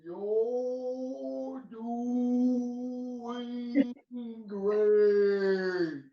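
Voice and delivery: drawn-out, extremely slow words; slow motion voice. Pretend. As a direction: stretch every syllable like slow motion. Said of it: "My slow motion voice".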